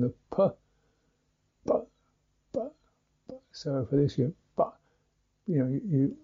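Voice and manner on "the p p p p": a popping, exploding "p"; plosive p. Shown as a demonstration. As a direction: burst the lips open on "p". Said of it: "a kind of exploding sound".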